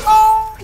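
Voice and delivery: loud high cry breaking into laughter; laughing in stunned disbelief; shocked laugh. Real, not acted. Streamer laughs at a wild game moment, loud and high.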